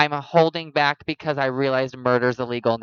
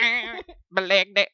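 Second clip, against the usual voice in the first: mock awkward excuse; 8 semitones higher than usual. Mocking friend dodging hug.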